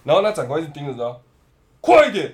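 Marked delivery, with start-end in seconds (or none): 1.8-2.3 loud, high hurry-up yell; impatient shout. Retold or imitated. Officer hurries the shaking cook, loud impatient shout. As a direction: push her to hurry, loudly.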